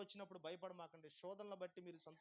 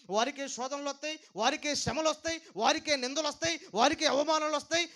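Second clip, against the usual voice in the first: roaring, rising preacher's climax, much higher and louder; preaching at full force; 9 semitones higher, 22 dB louder than usual. Acted. Pastor shouting to the congregation, preaching at full force. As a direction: build the shout line by line like a revival peak.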